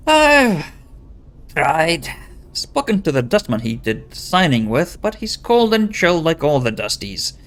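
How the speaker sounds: troubled wife with a put-on Scottish lilt; pseudo-Scottish accent. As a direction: plead her case in mock Scottish. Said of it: "a pseudo-Scottish accent".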